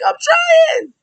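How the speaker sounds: shrieky high imitation of a protest; mock yelling. Wrestler answering the ringside yelling, mock high yell. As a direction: yelp it back in falsetto. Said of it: "She's always yelling when she's outside".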